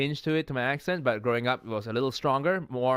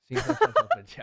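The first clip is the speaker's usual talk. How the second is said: high, loud mocking repeat; mock high echo; 4 dB louder than usual. Echoing district names, high mock squeal. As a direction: squeak the place names back.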